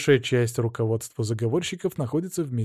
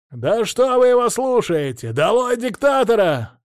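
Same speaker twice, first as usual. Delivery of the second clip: high, loud rebel shout; agitator shouting; 10 semitones higher, 6 dB louder than usual. A narrator's reading. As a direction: rouse the crowd, yelling.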